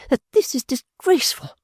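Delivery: put-on high, offended young lady stressing this; indignant. A narrator's reading. Fanny complaining to Pa, high and indignant. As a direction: spit out her outrage. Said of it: "flushed indignantly".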